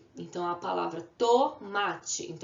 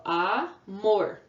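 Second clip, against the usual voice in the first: Amor with A held, then mor; syllable by syllable; 3 dB louder than usual. Shown as a demonstration. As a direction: pause between A and mor. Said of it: "duas vezes".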